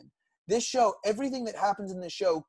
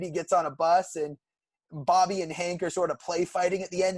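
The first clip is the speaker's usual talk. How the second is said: ordinary talk, nothing marked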